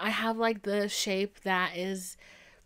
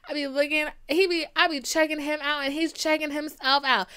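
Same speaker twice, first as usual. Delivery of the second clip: higher and louder through laughter; laughing; 5 semitones higher, 6 dB louder than usual. Real, not acted. Laughing while telling.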